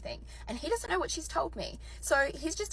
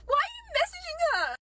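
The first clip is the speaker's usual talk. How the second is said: high-pitched indignant screech; outraged demand; 3 dB louder than usual. Retold or imitated. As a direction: screech the question at the husband. Confronting husband mid-meltdown.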